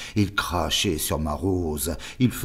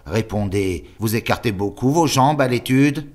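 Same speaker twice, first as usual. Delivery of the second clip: put-on higher, louder priest interrogating the boy; leering superior; 4 semitones higher, 5 dB louder than usual. The superior questioning the boy on his posture, insinuating. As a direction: press him with false gentleness.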